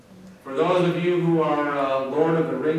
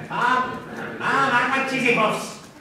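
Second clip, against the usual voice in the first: much higher and louder cartoon boy voice; Cartman; 10 semitones higher, 4 dB louder than usual. Lecturer doing Cartman for his students, much higher and louder. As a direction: pitch way up and belt it like a bratty cartoon kid. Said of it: "my best Cartman".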